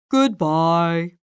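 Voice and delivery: low, quiet mournful farewell; tragic goodbye. Acted. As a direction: say goodbye softly and heavy. Tragic farewell after sneeze.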